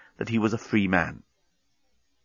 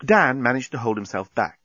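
usual speaking voice

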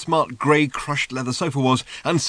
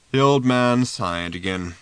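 ordinary delivery

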